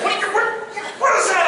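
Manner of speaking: high, loud mock outrage; berating instructor. Drill instructor at a cadet's botched attention, loud disbelief. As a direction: sputter it, loud and incredulous.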